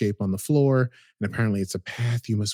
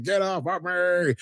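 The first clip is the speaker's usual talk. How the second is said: panicked cry; 7 semitones higher, slower than usual. Retold or imitated. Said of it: "he's like".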